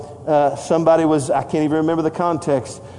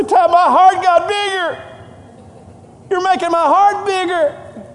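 tender to grandson; 14 semitones higher than usual. Retold or imitated.

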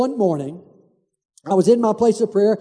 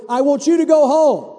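firm command; 5 semitones higher, 4 dB louder than usual. Retold or imitated. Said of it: "And he said".

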